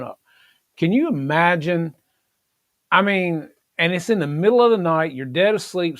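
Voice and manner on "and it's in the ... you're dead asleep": normal talking voice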